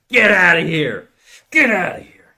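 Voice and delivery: high, strained dramatic shout; acted banishing yell. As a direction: drive the wolf off, hammed-up. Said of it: "So you're the wolf, and I'm telling you".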